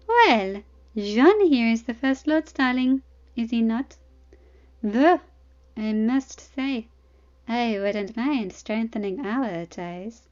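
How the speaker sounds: sensual smile. Acted. Arianne flirts with John in front of his wife, slow and sultry. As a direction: let each word linger with a sultry smile. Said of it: "with a sensual smile".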